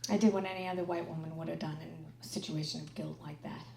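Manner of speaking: ordinary talk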